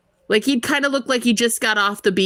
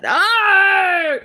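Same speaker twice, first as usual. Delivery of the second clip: surprised shout; 11 semitones higher, 4 dB louder than usual. Real, not acted. Reacting to California invite.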